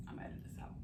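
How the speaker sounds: soft whisper. Real, not acted. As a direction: whisper it as an aside. Whispered aside to viewers.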